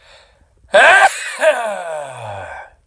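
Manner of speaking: loud explosive sneeze; big real sneeze, full force; sneezing. Real, not acted. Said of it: "is try to sneeze".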